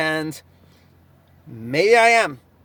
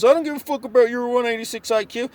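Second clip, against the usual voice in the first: lightly voiced doctor, higher and sneering; mocking the doctor; 6 semitones higher than usual. Retelling the doctor's jab in a mock voice. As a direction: mimic him dismissing the IQ brag. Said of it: "he goes".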